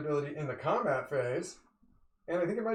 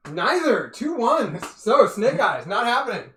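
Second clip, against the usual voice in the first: excited; 7 semitones higher, 9 dB louder, slower than usual. Real, not acted. Rolls snake eyes.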